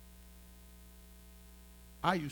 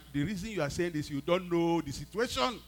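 heated retort; 5 semitones higher, 14 dB louder than usual. Retold or imitated. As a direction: argue back hard, stretching the last words. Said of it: "I said to her".

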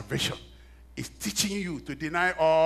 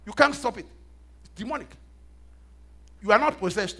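much higher and louder, driving; full-force preaching; 8 semitones higher, 9 dB louder than usual. Real, not acted. Preacher warning the church about anger, full force. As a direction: preach it hard to the crowd.

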